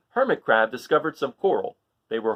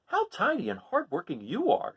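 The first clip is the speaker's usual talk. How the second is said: much higher admiring voice; admiring crab; 12 semitones higher than usual. Acted. Hermit Crab praises the tidy snails, high. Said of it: "said Hermit Crab".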